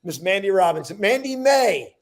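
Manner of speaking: building to a big ringside announcement; hype introduction. Real, not acted. Host announcing the next speaker, loud and rising. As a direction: boom out her name like a boxing announcer.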